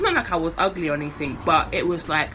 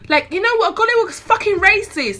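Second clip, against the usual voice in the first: higher, louder, outraged at the lyric; angry outburst; 11 semitones higher, 6 dB louder than usual. Real, not acted. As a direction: fire it back in real anger. Angry rant about racist lyrics.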